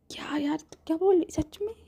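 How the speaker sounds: high, fast, breathless disbelief; excited disbelief. Acted. Friend overjoyed at the news, excited. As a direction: rush it out, thrilled.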